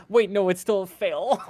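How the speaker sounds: surprised dismay. Real, not acted. Dice roll fails after relief.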